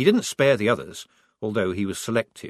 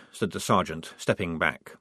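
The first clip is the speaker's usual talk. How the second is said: ordinary delivery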